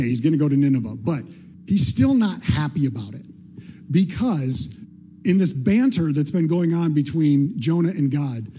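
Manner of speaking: normal talking voice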